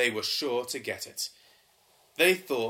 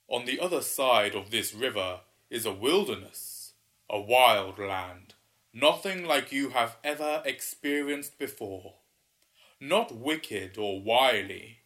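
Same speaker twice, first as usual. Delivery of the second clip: booming water spirit; 6 dB louder than usual. Acted. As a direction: boom it out like an ancient river spirit. Waterman speaks to the pair.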